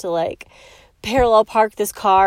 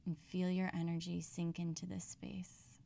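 whispered cue; 2 semitones lower, 21 dB quieter than usual. Acted. Breathing exercise, whispered cue.

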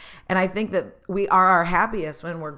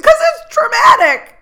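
much higher, louder, slow weepy wail; hammed-up sobbing reply to the dad; mock wailing cry; 15 dB louder, slower than usual. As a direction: wail it like you're bawling. Retells her own bawling reply.

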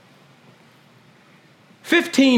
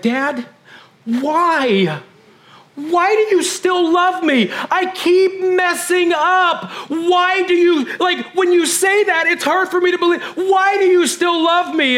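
imitating daughter bawling; 12 semitones higher, slower than usual. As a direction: plead like a sobbing teen. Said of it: "she's bawling".

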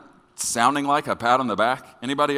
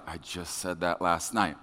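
sheepish low mumble; 9 semitones lower, 9 dB quieter than usual. Retold or imitated. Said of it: "Some of you are like".

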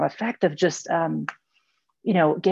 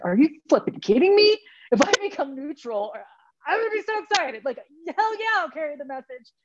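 squealing high, thrilled friend's voice; excited woman quoted; 10 semitones higher than usual. Retold or imitated. Woman thrilled to be asked to carry message, squealing high. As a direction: squeal it like she can't believe her luck. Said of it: "And she's like".

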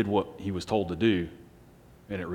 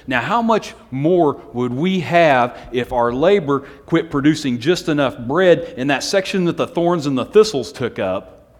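loud, raised-pitch preaching; forceful sermon delivery, voice lifted; impassioned preaching; 5 semitones higher, 9 dB louder than usual. Real, not acted. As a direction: drive the point home to the congregation. Preacher builds to full force.

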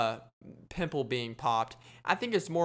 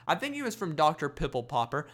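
normal talking voice

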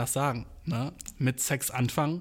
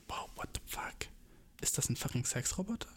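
breathless whispered shock; stunned boyfriend whispering; 12 dB quieter than usual. Retold or imitated. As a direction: hiss it out in disbelief.

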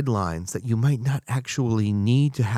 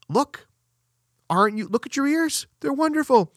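eager reassurance. Retold or imitated. Surgeon reassuring patient, acted quote.

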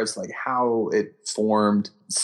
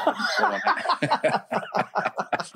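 breathy high laugh bursting out; burst of laughter; 11 semitones higher than usual. Real, not acted. Cohost cracks up at the James Bond eject joke, laughing hard.

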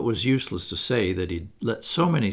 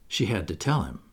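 ordinary delivery

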